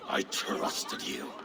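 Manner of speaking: slow, whispered, wounded; hurt whisper. Acted. Betrayed king to his old friend, wounded whisper. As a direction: breathe it out in disbelief.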